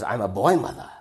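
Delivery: lightly voiced German-accented old lady; a hint of an elderly German mother; old German woman. Old woman tells two boys about herself, light German accent. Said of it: "this German woman with a German voice".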